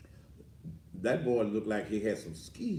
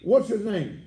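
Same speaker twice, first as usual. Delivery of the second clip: higher, louder demand into the phone; 10 semitones higher, 9 dB louder than usual. Real, not acted. Irritated man demands the caller's name on the phone, loud. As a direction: bark your question down the line.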